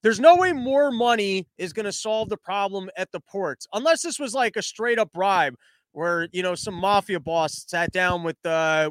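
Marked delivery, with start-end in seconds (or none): none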